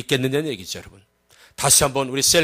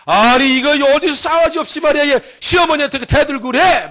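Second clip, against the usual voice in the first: raised voice, high and forceful; acted outraged scolding; angry father-in-law; 9 semitones higher, 5 dB louder than usual. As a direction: rebuke the young wife loudly. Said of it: "시아버지가 막 이제 화가 나고".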